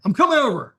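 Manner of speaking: loud, high, firm insistence; insistent friend. Retold or imitated. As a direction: refuse to take no for an answer.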